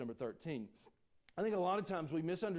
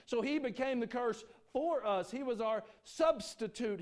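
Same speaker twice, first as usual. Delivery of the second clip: higher, louder pulpit preaching; preaching at full force; 9 semitones higher, 7 dB louder, slower than usual. Acted. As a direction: preach it hard to the congregation. Preaching climax on substitute.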